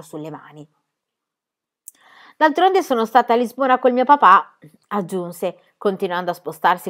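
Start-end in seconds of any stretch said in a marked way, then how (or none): 2.4-4.6 put-on higher, louder brash girl voice; cheeky girl character. A narrator's reading. Cheeky girl answering the adult about Portugal, brash. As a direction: play a bold, cheeky girl.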